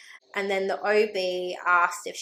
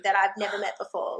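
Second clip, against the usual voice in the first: usual speaking voice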